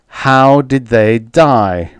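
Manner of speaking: slow, loud demand; snapped. A narrator's reading. Retief pressing Schlur about the captives, snapped. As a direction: demand it hard, word by word. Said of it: "Retief snapped".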